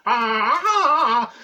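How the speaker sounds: high, loud Tarzan-style call; jungle yell. Pretend. Pretending to be George of the Jungle, loud jungle call. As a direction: belt out a jungle-man yell.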